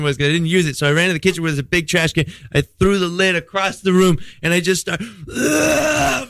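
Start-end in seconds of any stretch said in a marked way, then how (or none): 5.4-6.3 high mock retching groan; imitated vomiting. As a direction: act out throwing up with an "ugh". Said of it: "fucking throw it up in the thing".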